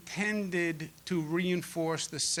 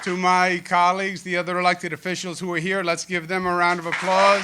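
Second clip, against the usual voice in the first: loud podium call to the room; raised, rallying public-speaking voice; calling for applause; 3 semitones higher, 8 dB louder than usual. Real, not acted. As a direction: project to the crowd and build to applause.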